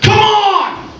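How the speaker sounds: high, drawn-out full shout; yelled down the street. Retold or imitated. Man with a crowbar challenging someone down the street, yelling. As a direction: holler it to someone far down the road. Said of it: "he yells down the street".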